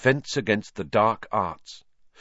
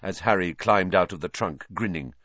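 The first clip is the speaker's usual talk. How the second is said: usual speaking voice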